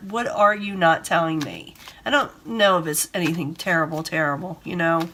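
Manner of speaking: ordinary delivery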